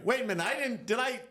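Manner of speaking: high, loud, laughing panic; a frantic, half-laughing second thought; panicked disbelief. Retold or imitated. Injured man doubting his choice to return, high and panicked. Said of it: "And I was screaming".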